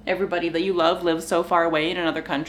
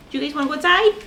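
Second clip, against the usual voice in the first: higher, cheerful question to the dogs; asking the dogs; 7 semitones higher, much faster than usual. Real, not acted. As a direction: Do you guys wanna go outside? asked brightly, rising at the end.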